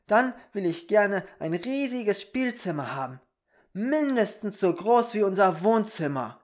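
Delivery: put-on high, loud excited boy's voice; eager little Peter. Peter asks fairy for a giant playroom, eager. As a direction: wish big like an eager child.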